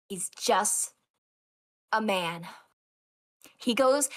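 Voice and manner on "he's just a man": low, lightly hammed-up line; cringy delivery. Eurylochus stealing the captain's line, hammed-up and low. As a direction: say it with cringy drama. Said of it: "he makes it so cringy".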